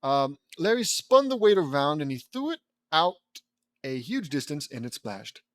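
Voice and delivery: ordinary delivery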